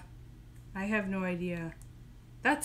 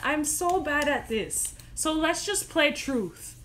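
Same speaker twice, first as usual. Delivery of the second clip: usual speaking voice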